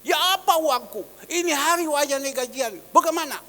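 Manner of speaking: loud, fast, rough demand for pay. Retold or imitated. Worker rudely demanding his pay from the pastor, loud and rough. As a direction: bark it like a worker demanding wages. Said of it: "ngomongnya kan kasar".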